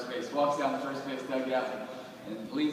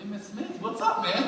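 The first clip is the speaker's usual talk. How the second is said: starstruck greeting; 7 semitones higher than usual. Retold or imitated. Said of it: "I'm like".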